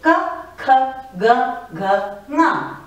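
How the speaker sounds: "Ka, kha, ga, gha, nga" read clearly, "kha" and "gha" breathy; teacher reading the row aloud with careful aspiration; slow, clear recital of a letter row; reciting consonants. Shown as a demonstration. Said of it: "let me read out the entire Vyanjan to you".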